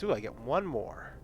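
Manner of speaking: ordinary talk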